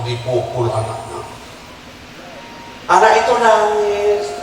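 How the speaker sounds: ordinary talk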